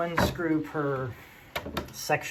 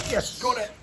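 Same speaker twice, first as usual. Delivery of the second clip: a hammy, put-on Scottish brogue, higher and louder; bad Scottish accent; 11 semitones higher, 5 dB louder than usual. Maker celebrating to the crew in bad Scottish. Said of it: "well, it's bad Scottish".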